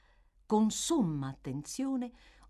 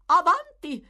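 high, loud call toward the door; shouted come in; 12 semitones higher, 12 dB louder than usual. Acted. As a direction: call the visitor in. Calls to a knock at door.

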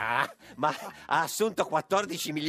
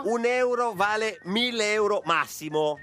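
showy game show host; 5 semitones higher, slower than usual. Retold or imitated. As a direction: announce it like a prize reveal. Host repeats the quote with mock pomp.

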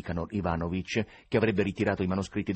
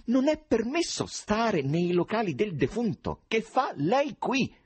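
high, slow, emphatic scolding; indignant rebuke; 13 semitones higher, 3 dB louder, slower than usual. A narrator's reading. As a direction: lay down the rule, offended. Rude president rebukes the intruder. (rimprovero sdegnato, scandito e acuto)

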